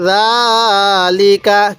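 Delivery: জালিকা read very slowly, the first vowel of জা held one alif long; slow drawn-out recitation. Shown as a demonstration. Teacher reciting জালিকা to students, slowly drawn out. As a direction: stretch জালিকা, holding জা and keeping লি and কা short. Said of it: "কীভাবে পড়ব".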